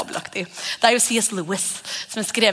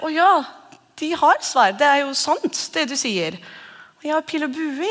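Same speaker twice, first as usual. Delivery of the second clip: naive archer; 5 semitones higher than usual. Acted. Archer naively believes the lie.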